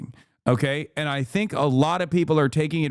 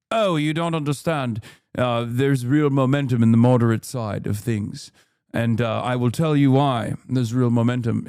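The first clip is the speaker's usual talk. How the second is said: lower-pitched mock posh British politician; posh British accent; 5 semitones lower, 3 dB louder than usual. Lib Dem defending the moderate side, mock posh British. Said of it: "keep using the f-, posh British accent".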